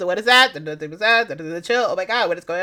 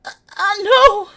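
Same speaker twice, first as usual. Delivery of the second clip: high drawn-out whine; 9 semitones higher, 5 dB louder than usual. Real, not acted.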